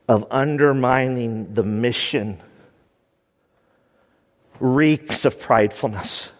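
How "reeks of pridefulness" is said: ordinary delivery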